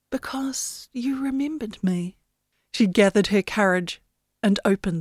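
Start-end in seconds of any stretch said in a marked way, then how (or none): 0.1-2.2 breathy unvoiced whisper, barely there. Wind whispering her answer to Yenny. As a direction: breathe it like a voice in the mind.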